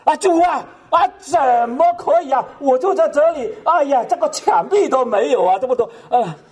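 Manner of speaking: high, pleading lament with a hint of tears; a retold wail of dismay to the Lord; dismayed man complaining to Jesus about his straw hut, half-crying; crying plea. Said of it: "开始哭起来了".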